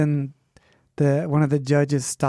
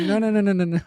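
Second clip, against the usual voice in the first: mock firm nurse refusal; 5 semitones higher, much faster than usual. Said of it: "And they're like".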